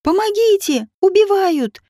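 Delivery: high cry for help, not full volume; narrated panic, pitched well up; desperate cry. A narrator's reading. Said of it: "Она кричала так отчаянно".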